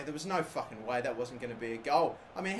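ordinary delivery

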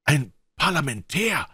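startled camp lookout's cry; calling out. A narrator's reading. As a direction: call out the news in a high voice. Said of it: "lautem Rufen".

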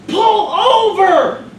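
high, loud, very slow command shout; barked order. Acted. Cop shouting at the ambulance driver again, loud slow yell.

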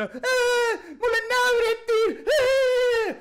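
high, whining mock sob voice; high bleating parody of a victim; mock whiny crybaby. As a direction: whimper it like a wounded crybaby. Mocking whiny gym-goer.

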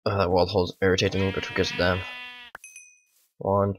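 ordinary delivery

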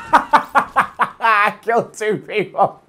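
drawn out slowly through laughter; giddy, laughing and slow; laughing in disbelief. Real, not acted. Disbelief after first kills.

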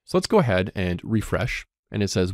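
ordinary talk, nothing marked

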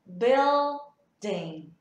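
"building" split slowly into BIL, ding; correct pronunciation. Shown as a demonstration. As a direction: sound each syllable of "building" apart, with clear L. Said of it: "cách đọc đúng của từ này là". (đọc chuẩn "building", tách từng âm BIL - ding)

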